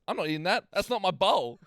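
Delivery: put-on stubborn kid refusal. Babysitter mimics Riley refusing the normal bowl, pouty. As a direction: refuse like a pouting toddler.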